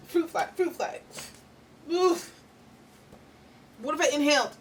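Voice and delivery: high, loud alarmed cries at a bug; real shock as a fly zips past her mouth; startled yelp. Real, not acted. Startled by a fruit fly, she yelps high and loud.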